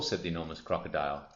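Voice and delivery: ordinary delivery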